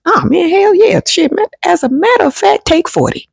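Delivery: eager yes. Retold or imitated. Friend happily agreeing to lend money, high excited.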